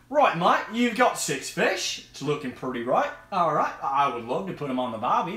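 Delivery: hammed-up Australian mate; swooping, exaggerated Australian accent; bad Australian accent. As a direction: do a badly faked Australian accent. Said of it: "Sorry for my Australian accent".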